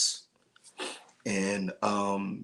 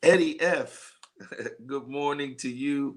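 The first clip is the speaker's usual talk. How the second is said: lively on-air hello to Eddie F; excited greeting; 5 semitones higher than usual. Real, not acted. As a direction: call out happily to a viewer who just joined. Greeting a viewer on live.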